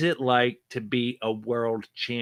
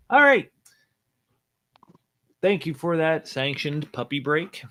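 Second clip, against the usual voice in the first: ordinary talk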